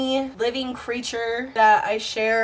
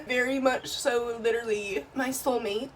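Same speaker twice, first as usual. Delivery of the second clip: usual speaking voice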